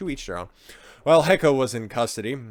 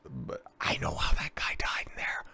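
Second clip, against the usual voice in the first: whisper; 6 semitones lower, 7 dB quieter than usual. Pretend. Drunk confiding to officer.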